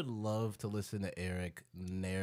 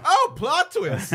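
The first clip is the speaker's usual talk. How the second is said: high, loud, drawn-out laughing surprise; mock gasp; 13 dB louder, much slower than usual. Hosts mocking an excited audiobook twist, laughing gasp. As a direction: gasp it out slowly through laughter.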